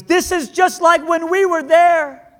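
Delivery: put-on high, loud, slow old man's cry across the theater; an old veteran shouting over the movie noise; loud yell. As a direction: call it out loud to a friend seats away. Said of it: "yells at the other guy really loud".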